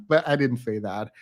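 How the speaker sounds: ordinary delivery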